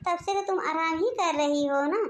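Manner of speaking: usual speaking voice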